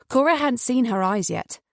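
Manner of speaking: usual speaking voice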